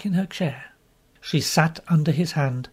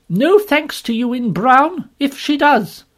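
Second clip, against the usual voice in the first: indignant retort; 9 semitones higher, 7 dB louder, slower than usual. Acted. Woman snaps back at Lorry.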